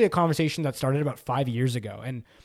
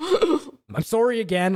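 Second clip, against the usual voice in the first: laughing; 8 semitones higher, much slower than usual. Pretend. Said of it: "get back to the Canadian side of me".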